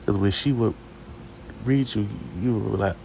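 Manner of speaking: normal talking voice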